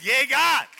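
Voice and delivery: loud, high cheer; joyful shout. Retold or imitated. Pastor celebrating his healing with a loud high cheer. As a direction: cheer it out in triumph.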